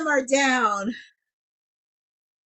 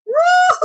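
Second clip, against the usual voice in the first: high cheer; 6 dB louder than usual. Real, not acted.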